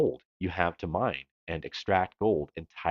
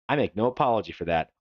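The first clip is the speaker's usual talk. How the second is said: usual speaking voice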